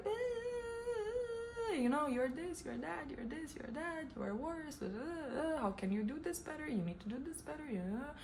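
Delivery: high, soft, whiny nagging voice; whiny inner critic. Retold or imitated. Her inner critic nagging her, high, soft and whiny. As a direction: nag at yourself like a mean little voice. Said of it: "I hear that self-critic being like".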